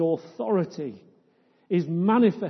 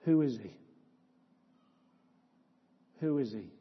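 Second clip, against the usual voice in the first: softer, lower, let it hang; hushed question; 5 semitones lower, 5 dB quieter, faster than usual. Real, not acted. Preacher pausing after the crucifixion list, hushed. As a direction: ask it almost under the breath.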